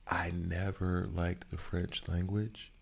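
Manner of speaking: hushed confession, whispered; secretive whisper about French. Real, not acted. Confessing dislike.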